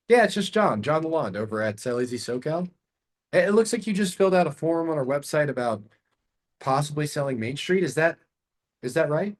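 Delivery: like a friend checking in; familiar, curious tone. Shown as a demonstration. Caller John asking seller about the form, familiar and curious. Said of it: "Now I'm using a familiar tone".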